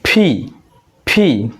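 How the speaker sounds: ফি with both lips pressed and a burst of air; slow demo of ফি with a strong puff. Shown as a demonstration. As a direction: push air forward through closed lips on ফি. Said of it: "দুইটা ঠোঁট হচ্ছে একত্রিত করব".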